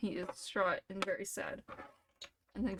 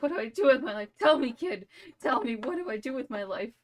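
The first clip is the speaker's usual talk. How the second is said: desperate plea; 3 semitones higher, 6 dB louder than usual. Acted. Wounded Robert begs the kid for meaning, loudly. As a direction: beg the kid for an answer, half-shouting.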